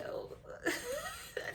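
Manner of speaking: high squeaky laugh. Real, not acted. Laughs at silly synopsis.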